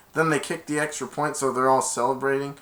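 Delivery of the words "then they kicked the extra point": ordinary delivery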